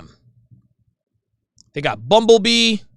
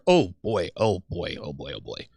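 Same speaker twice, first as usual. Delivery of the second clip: fading; 12 semitones lower, 9 dB quieter than usual. Real, not acted. Repeats oh boy fading away.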